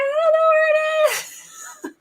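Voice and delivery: high, slow, stretched-out wail; panicked reply. Retold or imitated. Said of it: "And I'm like".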